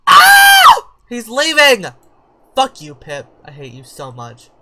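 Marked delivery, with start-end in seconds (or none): 0.1-0.9 sharp gasp into a high piercing shriek; startled scream at the screen. Real, not acted. Player sees her sim leave, shrieking gasp. 1.1-1.8 high, loud panicked yell; alarmed shout. Real, not acted. Player yelling as a sim walks out on her. 2.5-3.3 louder jokey outburst; mock angry. Real, not acted. Player cursing at the sim Pip, loud mock anger.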